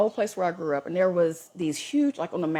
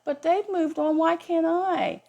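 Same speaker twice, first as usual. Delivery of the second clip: higher, slow whiny plea; mock the ego's complaint; 9 semitones higher, slower than usual. Ego whining about the exes, mock whiny. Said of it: "your ego will say".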